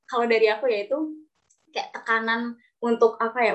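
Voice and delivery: ordinary talk, nothing marked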